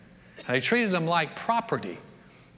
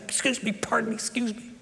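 higher, fading apologetic excuse mes; timid polite pushing; 3 semitones higher than usual. Retold or imitated. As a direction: squeeze through a crowd shyly. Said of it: "she had to push through that".

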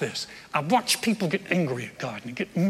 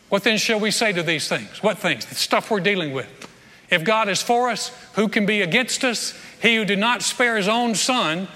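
scripture reading; 3 semitones higher, 3 dB louder than usual. Acted. Reads Romans eight aloud.

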